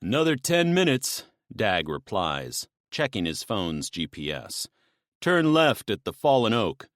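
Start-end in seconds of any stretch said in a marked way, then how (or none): 0.0-1.2 much higher and louder; different man's voice. Acted. Second man answers. 5.2-6.9 much higher and louder; different man's voice. Acted. Giving directions loudly.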